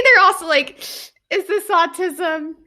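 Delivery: ordinary talk, nothing marked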